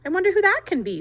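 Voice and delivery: high, loud mouse voice; lightly voiced sleepy mouse. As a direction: wonder aloud like a drowsy mouse. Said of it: "said the sleepy mouse".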